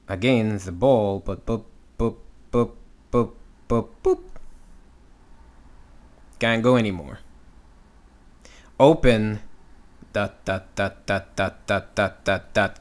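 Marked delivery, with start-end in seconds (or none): 1.5-4.2 short, separate "boop" pops; little staccato "boop" noises for a stopping ball; bouncing-ball sound. Shown as a demonstration. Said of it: "to remember close, just think of". 10.1-12.8 even, staccato run of "dot"; quick repeated "dot" beats at one steady pitch; continuing-motion demo. Shown as a demonstration. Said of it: "Keep going".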